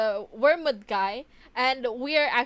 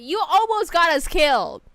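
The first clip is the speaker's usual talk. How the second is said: loud yelling rebuke; furious yell at the screen; mock outrage; 4 semitones higher, 10 dB louder than usual. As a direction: shout it at the old woman. Angry outburst at game character.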